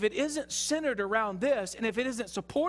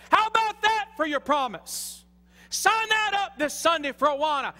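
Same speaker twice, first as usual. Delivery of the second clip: building to a high, loud holler; excited preaching shout; 7 semitones higher, 5 dB louder than usual. Real, not acted. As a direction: holler it at the congregation, fired up. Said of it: "I just get excited about it".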